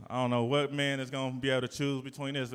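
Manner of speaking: normal talking voice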